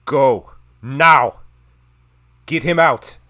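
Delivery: loud, high-pitched command; urgent shouted order. Acted. Orazar ordering the party out, urgent shout. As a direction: shout it urgently to the whole group. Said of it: "turns to the rest of you and says".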